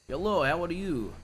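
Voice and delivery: a light put-on Russian accent. Shown as a demonstration. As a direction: greet them with a mild Russian accent. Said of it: "I'll do a Russian accent".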